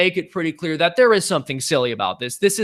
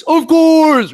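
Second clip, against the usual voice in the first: much higher and louder jank voice; 13 semitones higher, 9 dB louder than usual. Pretend. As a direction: burst out in a silly jank voice. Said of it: "can you answer the next question in your jank voice?".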